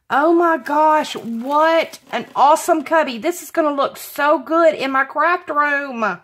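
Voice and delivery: thrilled. Real, not acted. Crafter gushing over a gifted cubby, thrilled. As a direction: gush over the gift.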